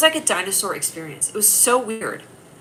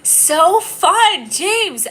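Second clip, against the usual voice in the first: much higher, louder, playful sing-song; gleeful squeal; 11 semitones higher, 7 dB louder, much slower than usual. Real, not acted. Teasing a commenter named James while reporting him, gleeful high squeal. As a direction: delight in sending him off.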